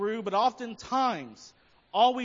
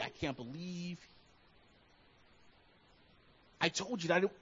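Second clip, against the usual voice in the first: muttered angry rant; 4 semitones lower, 9 dB quieter than usual. Retold or imitated. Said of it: "just angry".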